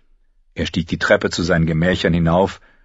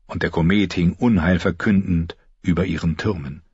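plain reading voice

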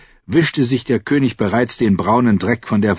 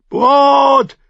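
high, loud one-word yell; demanding shout; 7 dB louder than usual. A narrator's reading. Hungry man behind the woman, shouting. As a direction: holler the demand. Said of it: "verlangte der Mann hinter ihr".

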